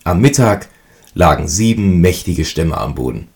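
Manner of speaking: ordinary delivery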